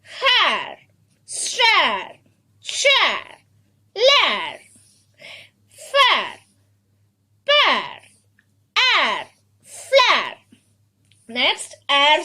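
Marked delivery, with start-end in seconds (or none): 0.0-10.4 each word from "Hair" to "flare" drawn out, high then falling; blended word drill. Shown as a demonstration. Teacher rereads the list, drilled blends. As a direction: recite the words singly with pauses. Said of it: "Let us read once again".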